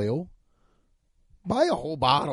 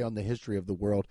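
softly corrected; 6 semitones lower, 6 dB quieter, faster than usual. Shown as a demonstration. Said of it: "I don't even know how to say".

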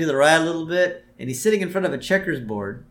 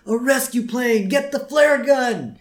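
high, drawn-out call; slow cartoon cry of excitement, pitched way up; Bart's excited shout; 10 semitones higher, 3 dB louder, slower than usual. Boy on the raft spotting rescue, excited call. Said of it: "and Bart says".

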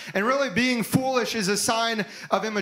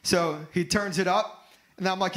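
ordinary talk

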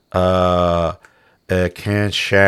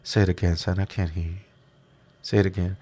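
soft, calm taunt; gently threatening, very quiet; docile voice; 2 semitones lower, 8 dB quieter, faster than usual. Retold or imitated. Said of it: "it was in a very docile voice".

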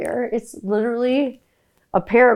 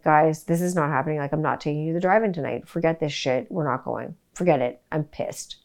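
retold anger; 4 semitones lower, 7 dB quieter than usual. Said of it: "So I was like".

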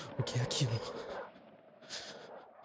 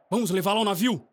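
urgent call; 8 semitones higher, 5 dB louder, faster than usual. Acted. Urging crew to carry man.

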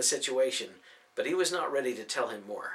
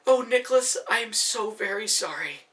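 mild tearful sympathy; 8 semitones higher, 4 dB louder than usual. Acted.

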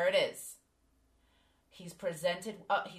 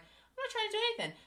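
much higher, fast protest; much faster than usual. Retold or imitated. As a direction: squeak out the denial in a high voice. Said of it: "And I'm like".

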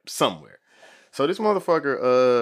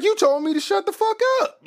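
cheeky retort in a much higher put-on voice; throwing the cop's own words back at him; sarcastic comeback. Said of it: "I was like".

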